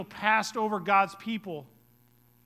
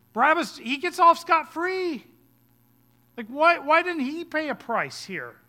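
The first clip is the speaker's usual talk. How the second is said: higher, louder outraged protest; indignant disbelief; 6 semitones higher, 4 dB louder than usual. Acted. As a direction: protest as if at an unfair verdict. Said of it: "it's like".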